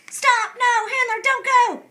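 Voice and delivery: alarmed shout. Acted. Olive screams a warning.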